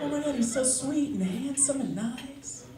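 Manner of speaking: high, slow, soft mock swoon; gushing woman. Women watching a romantic movie, mock swooning. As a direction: coo over a dreamy man in a high voice.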